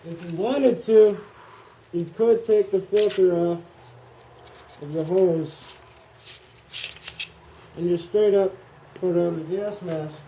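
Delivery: muffled voice inside a gas mask; through a gas mask. Real, not acted. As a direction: talk with the mask over your face. Demoing the gas mask while wearing it.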